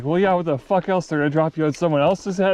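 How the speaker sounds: mock the kid's question, fed up; exasperated Billy Bob. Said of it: "he's like".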